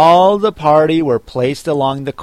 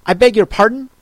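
higher-pitched, very polite query; frowning politeness; 8 semitones higher, faster than usual. A narrator's reading. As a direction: ask politely through a frown. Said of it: "frowning but very politely".

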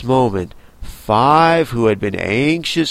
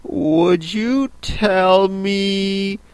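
slow, hesitant and higher; timid; 7 semitones higher, much slower than usual. Acted. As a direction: ask shyly, half afraid. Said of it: "said Alice a little timidly".

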